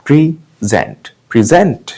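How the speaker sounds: "Present" as pri-ZENT, slow then joined up; short "pri", then press on ZENT in "present"; second-syllable stress. Shown as a demonstration. Teacher showing the verb, "present" as pri-ZENT. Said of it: "gent पे stress आया".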